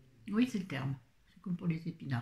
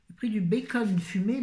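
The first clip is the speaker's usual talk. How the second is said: normal talking voice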